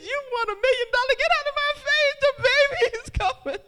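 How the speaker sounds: very high, loud, wailing dismissal; hammed-up screeching desperation; frantic shouting. Laboring woman chasing someone off, frantic shouting.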